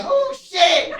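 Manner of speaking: very high-pitched shriek of horror; high, piercing comic shriek; mock scream. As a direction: imitate a horrified passenger screaming in a squeal. Voices lady hit behind him.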